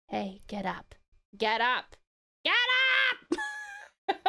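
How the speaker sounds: hammed-up morning alarm; morning wake-up. Shown as a demonstration. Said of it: "And then the morning pack is".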